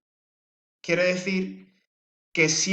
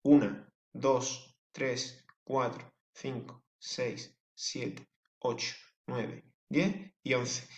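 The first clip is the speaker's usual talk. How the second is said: soft, slow recount of the syllables; counting again quietly; 5 semitones lower, 6 dB quieter, much slower than usual. Shown as a demonstration. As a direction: count them again, softly under the breath. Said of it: "¿Vale?".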